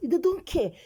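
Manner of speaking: high, loud they don't care; exasperated outcry. Real, not acted. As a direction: cry it out in frustration. Ranting about police.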